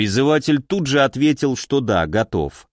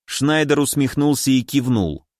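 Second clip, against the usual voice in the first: ordinary delivery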